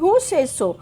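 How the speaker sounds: high, sharp questioning lion; lion's demand. Acted. Lion questions the parrot sharply. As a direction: demand an answer as the king.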